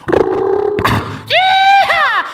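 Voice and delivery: high, loud yeehaw holler; hammed-up yeehaw; mock cowboy yell. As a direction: whoop it like a rodeo ad. Gatorland phone ad mock.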